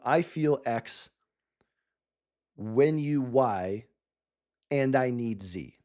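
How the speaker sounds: slow, deliberate, each part set apart by long pauses; slow formula. Shown as a demonstration. Coach spelling out the feedback formula for founders, slow and deliberate. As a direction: spell out the formula piece by piece, slowly. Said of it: "what I want you to do is to think".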